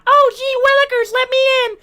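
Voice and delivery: put-on very high squeaky kid voice; eager mock-child squeal; gullible Kyle. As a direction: play an excited, naive little boy. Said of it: "Kyle's over here like".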